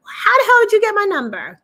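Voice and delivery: high, loud and fast, reliving the shock; shocked demand. Retold or imitated. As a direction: blurt it out, stunned and angry. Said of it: "My first question was".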